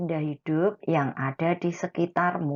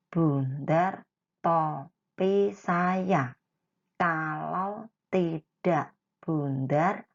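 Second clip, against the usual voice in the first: each word read out slowly for the class; slow read-aloud; slower than usual. Shown as a demonstration. Teacher reads the song lyric to the children, slow and clear. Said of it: "Mari kita baca dulu".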